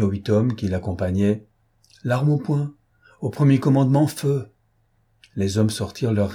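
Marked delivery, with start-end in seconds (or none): 2.0-4.5 higher-pitched order to the men; military command. A narrator's reading. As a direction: instruct armed men as their chief. Deputy chief orders his armed men.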